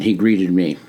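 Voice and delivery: plain reading voice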